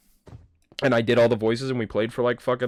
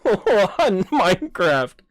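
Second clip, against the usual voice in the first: laughing; 11 semitones higher, 9 dB louder, much slower than usual. Real, not acted. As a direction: laugh breaking through the words, high and loud. Laughs at his own recap.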